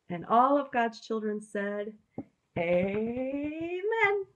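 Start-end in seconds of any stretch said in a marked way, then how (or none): 2.6-4.3 higher, drawn-out Amen. Real, not acted. Congregation amen cue.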